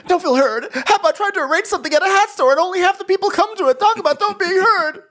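very high, fast hammed-up rant; frantic high-pitched comic tirade; shrieked mock outrage. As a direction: screech it in exaggerated indignation. [name]'s outraged interruption, acted.

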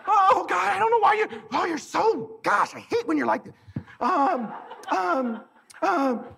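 high, flustered stammering wife. Retold or imitated. Wife flustered while ordering.

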